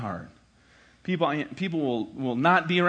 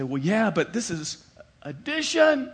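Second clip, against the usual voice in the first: slow, higher put-on voice; mock sheepish excuse; 6 semitones higher, slower than usual. Sheepish drawn-out excuse. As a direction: math PhD excusing himself from helping son, sheepish.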